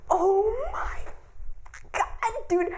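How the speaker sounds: gasping, then a high, breaking oh my god; real overwhelmed cry of delight. Real, not acted. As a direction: caught between tears and joy. Overwhelmed by the cathartic moment.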